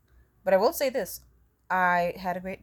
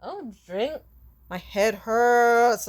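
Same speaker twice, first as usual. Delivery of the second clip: drowsy concussed; 3 semitones higher, 4 dB louder, much slower than usual. Retold or imitated. Concussed self, acted drowsy.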